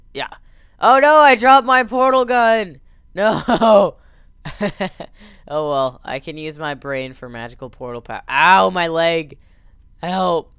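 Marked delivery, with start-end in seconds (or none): 0.8-4.0 hammed-up high, loud dismay; mock panic. Drops gun in game. 8.3-10.5 slow, loud hammed-up ow; mock pain. Character loses a leg.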